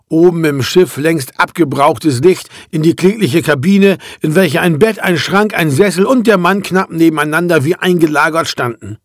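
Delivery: ordinary delivery, nothing marked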